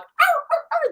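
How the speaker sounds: high yelps of a shot dog; a dog, just shot, yelping high. Acted. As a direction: yelp out in pain like a dog.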